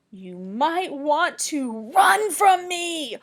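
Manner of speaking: slow, loud looming warning; spooky riddle read. Acted. Teacher reads the riddle's scary last line to kids. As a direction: draw it out to thrill the kids.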